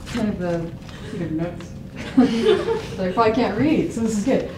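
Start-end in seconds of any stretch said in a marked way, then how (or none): 1.8-2.9 short breathy nervous laugh. Real, not acted. Nervous laugh before speech.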